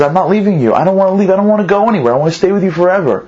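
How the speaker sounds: a small son begging his parents not to send him away; hint of a tearful child; crying little boy. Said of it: "starts to cry and says".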